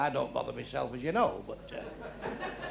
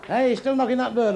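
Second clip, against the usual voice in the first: compere shout; 5 semitones higher, 5 dB louder than usual. Real, not acted. Praising pianist over music.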